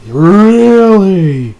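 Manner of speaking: loud, long drawn-out mock doubt; sceptical. Reviewer teasing Mishima about his research excuse, loudly sceptical. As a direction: stretch it out in disbelief. Mocking a friend's flimsy excuse.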